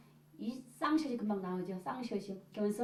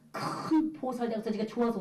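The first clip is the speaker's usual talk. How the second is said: laughing while retelling. Retold or imitated. Quoting the woman's laughing plan.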